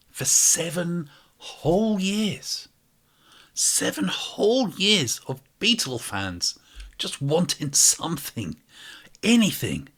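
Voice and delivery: slow, high, stretched out whole; theatrical slow lament; dramatic lament. Acted. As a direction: drag it out in mock despair.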